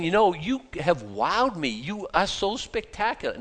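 slow, high-pitched mock admiration; mock gushing. Preacher acting out what God is not saying, mock gushing. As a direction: gush slowly and high, as if wowed. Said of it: "he's not saying".

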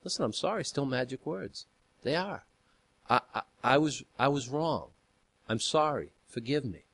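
softly re-enacted humble apology; gentle mock apology. Husband showing how he apologised to his wife, soft apology. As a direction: drop the volume and own up, haltingly.

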